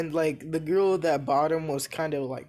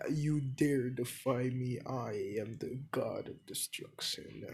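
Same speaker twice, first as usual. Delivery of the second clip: low, slow, much softer god voice; deep voice; 3 semitones lower, 8 dB quieter than usual. Acted. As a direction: sink into a deep menacing rumble. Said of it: "one of my deepest voices".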